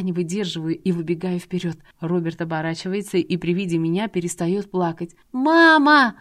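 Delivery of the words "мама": put-on high, loud child cry; a little boy crying out for mother; a frightened boy shouting for his mother; child's shout. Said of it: "кричит мальчик".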